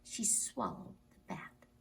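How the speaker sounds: hushed story whisper. Acted. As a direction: whisper it in awe. Whispered aside.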